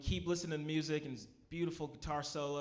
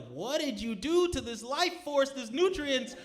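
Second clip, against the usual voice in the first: high indignation building to a loud shout; hammed-up horrified scolding; mock outrage; 11 semitones higher, 11 dB louder than usual. Tripper confronts groom who crushed burger, outraged.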